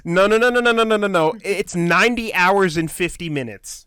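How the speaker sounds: rapid-fire loud volley of objection; emphatic correction. Real, not acted. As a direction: cut in loudly to set them straight. Loudly correcting a friend's wrong figure.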